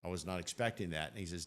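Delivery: ordinary talk, nothing marked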